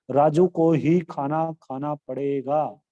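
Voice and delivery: slow, with ही stressed to push Raju. Shown as a demonstration. Teacher shows students how ही stresses Raju, slow and emphatic. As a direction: lean on ही so Raju stands out. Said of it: "Raju संज्ञा पद पर बल देने का काम".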